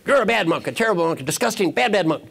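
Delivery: high, emphatic mock scolding; hammed-up outrage, pitched high; angry monk. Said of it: "The other monk is angry".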